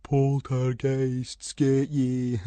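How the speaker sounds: slow ghost-story tease; mocking friend playing at ghosts; spooky teasing. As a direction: drag it out like a spooky tale.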